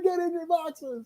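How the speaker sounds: high, soft, slow scolding; mock mad. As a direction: imitate an angry order in a small high voice. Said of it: "She's so mad".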